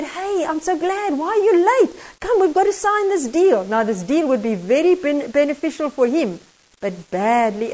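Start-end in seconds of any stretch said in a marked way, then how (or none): 0.0-3.6 higher, brisk voice of a pushy client; eager businessman. Acted. Eager businessman urges the late man to sign, high and pushy. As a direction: greet him impatiently, hurrying him to sign.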